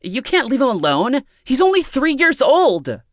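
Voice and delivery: very high, panicked protest; rising panic. A narrator's reading. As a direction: plead for the child as panic climbs. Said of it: "Amanda's voice was rising, becoming panicked".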